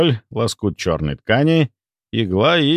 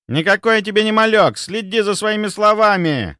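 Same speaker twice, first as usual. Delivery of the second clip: higher, sharp hissed warning; hissing; 11 semitones higher than usual. A narrator's reading. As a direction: hiss it at him with contempt. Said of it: "прошипел Андрей".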